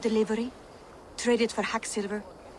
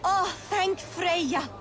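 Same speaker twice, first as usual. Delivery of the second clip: slow, higher breath of relief; relieved sigh; 9 semitones higher, much slower than usual. Acted. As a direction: let the fear drain out slowly. Relief daughter not dead.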